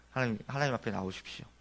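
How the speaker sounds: hushed call to come before God; soft invitation. Acted. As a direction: say it gently, almost to yourself. Preacher repeats the call.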